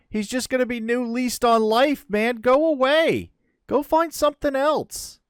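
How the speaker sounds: high, loud begging; desperate pleading. Real, not acted. Gamer begging the predator to leave his raptor, loud and high. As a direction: shoo the beast away, pleading hard.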